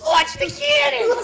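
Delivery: high laughing yell; laughing shout. Pretend.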